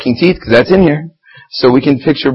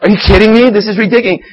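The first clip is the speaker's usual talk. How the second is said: high, heated disbelief; a fed-up mock rant; mock outraged Jesus; 8 semitones higher than usual. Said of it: "He was like".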